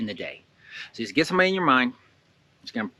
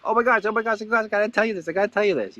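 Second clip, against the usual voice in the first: high, giddy, bursting with news; breathless excitement, much higher; all excited; 9 semitones higher, faster than usual. Shown as a demonstration. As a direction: gush like you can't hold the secret in. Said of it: "I'm just all excited".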